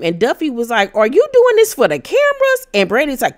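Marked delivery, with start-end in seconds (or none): 1.0-2.7 imitated high-pitched accusing question; mock-incredulous challenge, pitch shooting up. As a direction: quote it in squeaky indignation. Acted quarrel, accusing question.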